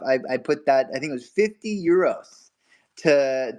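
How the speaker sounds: ordinary delivery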